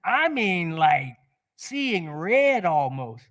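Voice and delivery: ordinary delivery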